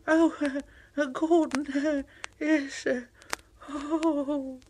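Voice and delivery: high, halting reluctance; hesitant little engine. Acted. Thomas nervously hearing Gordon will come. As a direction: stall nervously.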